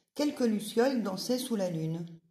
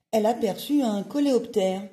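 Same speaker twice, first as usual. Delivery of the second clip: ordinary delivery, nothing marked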